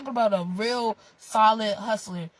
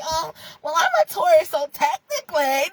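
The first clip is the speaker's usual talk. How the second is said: higher, louder mock objection; 8 semitones higher, 6 dB louder than usual. Vlogger mimicking Taurus viewers who nitpick, mocking.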